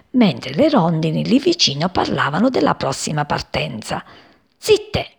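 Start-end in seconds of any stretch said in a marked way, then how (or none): 4.6-5.1 high, sharp hushing call; mild cry. A narrator's reading. Mother sparrow hushes all the swallows, mild high cry. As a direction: call for silence high, without much volume. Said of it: "gridò Passerì a tutte quante".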